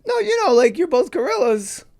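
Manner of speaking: very high, loud crazed director; batshit crazy auteur. Retold or imitated. Director insisting to a puzzled actor, batshit crazy auteur. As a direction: squeal the explanation as if it were obvious. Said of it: "looking like a batshit crazy auteur".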